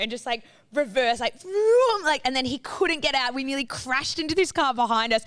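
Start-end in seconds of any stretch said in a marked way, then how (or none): 1.4-2.0 higher, louder imitated engine vroom. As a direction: mimic the car reversing fast. Imitating car reversing.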